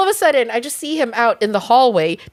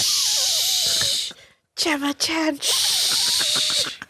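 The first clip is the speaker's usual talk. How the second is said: put-on drunk shush; 6 dB quieter, much slower than usual. Shushing friend while sneaking out.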